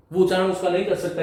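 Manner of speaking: usual speaking voice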